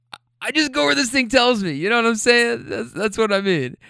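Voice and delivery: high-pitched, flustered protest; mock defensive. Driver defending his route to granny, high mock protest. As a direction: act out pleading your case, voice up high.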